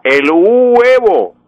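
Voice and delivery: "el huevo" with a long high u, uuu-E-vo; diphthong split into hiatus. Shown as a demonstration. Professor shows the wrong huevo, slow high split u. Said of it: "no puedo decir".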